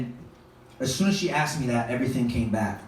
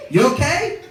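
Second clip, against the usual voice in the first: wife voice; 10 semitones higher, 4 dB louder than usual. Retold or imitated. Wife's question voiced.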